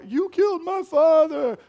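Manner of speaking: high, loud cry of Luke. Retold or imitated. Preacher voicing crying Luke's accusation. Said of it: "he's like".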